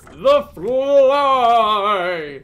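loud, drawn-out mock-dramatic cry; hammed-up movie title shout. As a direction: bellow the title, stretching it out playfully. Hams up a classic movie title.

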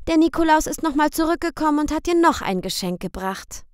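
put-on high, bright girl calling out; high calling girl's voice. A narrator's reading. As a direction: call the boy over like an eager girl. Girl's line continues.

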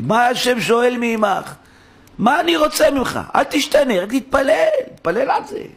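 high, drawn-out exhortation; eindringliches Rufen. Acted. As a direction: cry it out as God's urgent demand. Preacher voices God's demand at full force.

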